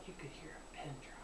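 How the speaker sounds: hushed whisper. Retold or imitated. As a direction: whisper it like the stadium went silent. Stadium gone silent.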